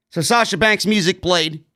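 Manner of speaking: ordinary delivery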